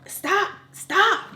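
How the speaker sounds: high, sharp retold command; mock mad. Mom really mad at crying daughter, mock angry. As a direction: imitate an exasperated mom's high-pitched order. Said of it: "just really mad with me".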